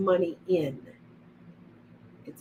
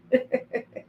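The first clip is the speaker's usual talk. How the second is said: a quick high-pitched giggle; little amused laugh. Real, not acted. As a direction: giggle at yourself. Laughing at own tie-dye glasses.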